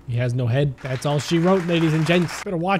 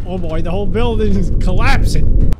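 much louder, thrilled outburst; excited loud; 10 dB louder than usual. Real, not acted. Streamer shouting as the game building collapses. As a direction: blurt it out as the walls fall.

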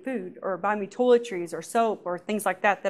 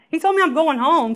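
usual speaking voice

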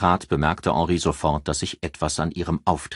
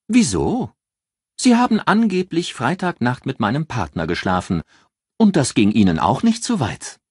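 challenging retort; 7 semitones higher, 5 dB louder than usual. Acted. Ann confronts her rival.

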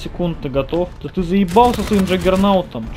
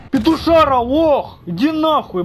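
raging gamer; 8 semitones higher than usual. Real, not acted. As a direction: scream insults after losing. Loses match, yells at friend.

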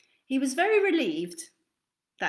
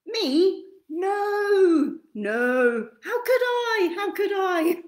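high, loud, whiny hare; high whining denial, on the edge of crying; 9 semitones higher, 6 dB louder, much slower than usual. Acted. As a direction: protest like a nervous little hare. Hare answers.

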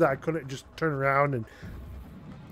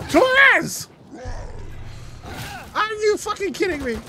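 furious shout. Real, not acted. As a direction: scream at the partner in disbelief. Yelling at AI partner.

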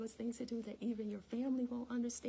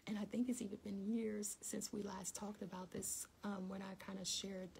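ordinary delivery

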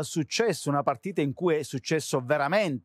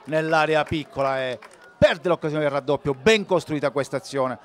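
rising excited commentary; 9 dB louder than usual. Acted. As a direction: build up to the chance. Live play-by-play of a chance.

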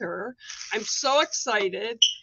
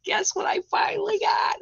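teasing whisper; 8 semitones higher than usual. Acted. Host teases viewers about her new deck, stage whisper. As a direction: whisper it like a secret, high and breathy.

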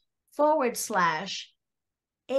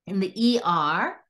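normal talking voice

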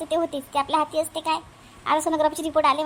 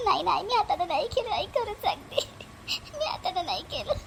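tearful denial; 4 dB quieter than usual. Acted. Girl denies through tears.

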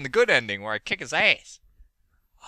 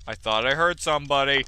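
usual speaking voice

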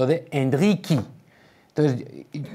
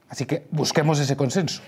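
ordinary talk

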